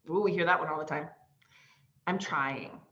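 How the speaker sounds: normal talking voice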